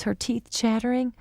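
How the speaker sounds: usual speaking voice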